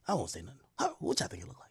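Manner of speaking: playful whisper. Pretend. Teasing the audience in a hush.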